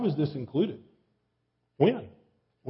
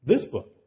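"this" hit louder than "book"; stress on THIS; 5 dB louder than usual. Shown as a demonstration. Preacher contrasting it with any book, stressing "this". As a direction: punch "this" to single out the book. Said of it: "You begin to emphasize different words".

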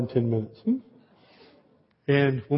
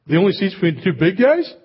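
flustered complaint; 6 semitones higher, 5 dB louder than usual. Retold or imitated. Flustered dad complains to steward.

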